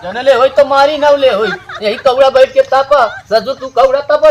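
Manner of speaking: loud, heated telling-off; angry scolding. Acted. Parent berating a grown child by the fire, angry scolding. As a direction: scold with rising volume and force. Acted family quarrel, scolding.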